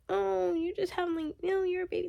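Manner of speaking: high, soft mock-whine; mockingly high, thin imitation. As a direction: mimic them in a small high singsong. Mimicking dismissive people who criticise her.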